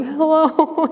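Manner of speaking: high-pitched imitation of tearful wailing; mock ugly crying. Imitating herself sobbing before the priest, mock ugly crying. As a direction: put on an exaggerated crying hello. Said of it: "like, the ugly crying".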